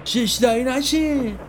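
high, stretched-out teasing call; shouted taunt. A narrator's reading. Sohrab from the car window to neighbourhood kids, shouting. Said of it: "بلند داد زد".

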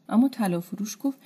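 usual speaking voice

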